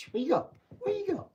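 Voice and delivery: high cooing pet talk. Real, not acted. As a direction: squeak at the puppy.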